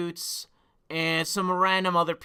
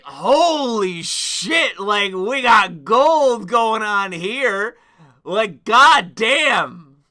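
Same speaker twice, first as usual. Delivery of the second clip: awed exclamation; 3 semitones higher, 7 dB louder, much slower than usual. Retold or imitated. Stunned by the pictures.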